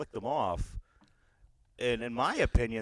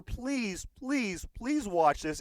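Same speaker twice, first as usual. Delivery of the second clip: pleading; 9 semitones higher, much slower than usual. Real, not acted. Urging listeners to watch.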